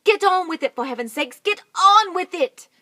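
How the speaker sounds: loud, high exasperated yelling; impatient Mugglewump shouting. Acted. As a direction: bark them into action.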